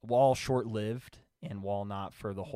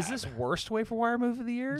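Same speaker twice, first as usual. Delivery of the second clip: high, incredulous teasing question; mocking; 4 dB louder than usual. Real, not acted. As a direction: squeal it in mock disbelief. Teasing a bad pick.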